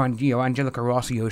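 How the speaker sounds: ordinary delivery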